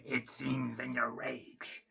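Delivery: slow, hushed, breathy sinister emperor; Palpatine quote. Retold or imitated. Fan quoting Palpatine to Anakin, slow sinister hush. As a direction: creep it out slowly like the Emperor. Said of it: "And then Palpatine".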